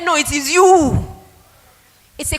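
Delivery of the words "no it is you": higher, louder, drawn-out proud reply; proud retort. Retold or imitated. Daughter answers who is best in class, proudly emphatic. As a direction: imitate a child declaring it emphatically, stretched out.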